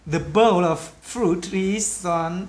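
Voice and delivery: slow but linked "bowl of fruit is on"; connected words. Shown as a demonstration. Teacher demonstrates connecting sounds, slow linked "the bowl of fruit is on". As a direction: carry "bowl" into "of" and "fruit" into "is on", slowly. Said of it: "kết nối là".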